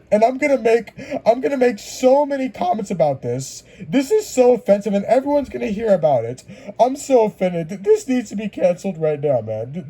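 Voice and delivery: hammed-up offended whine; mocking offended snowflake. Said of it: "they call for".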